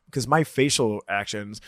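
normal talking voice

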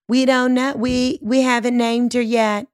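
lightly voiced complaining neighbor, pitched a bit high; hint of a gloomy oversharing neighbor; Debbie Downer neighbor. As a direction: play the neighbor dragging out her woes. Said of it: "She's a Debbie Downer".

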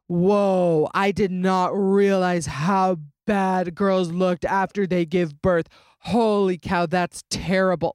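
slow, drawn-out imitation of a shocked commenter; lightly put-on appalled voice, stretching the words; mock shock. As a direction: mimic a nasty online comment, slowly.

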